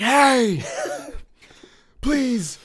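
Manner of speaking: mock polite neighbor. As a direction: ask politely but firmly, drawn out. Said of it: "He's like".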